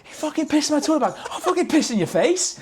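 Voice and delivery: high-pitched, loud and fast mock tirade; retold drunken rage. Storming out at a sleeping roommate over the toilet bag, retold rage. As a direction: re-enact seeing red, voice shooting right up. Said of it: "seen red".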